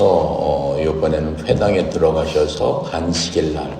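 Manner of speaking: normal talking voice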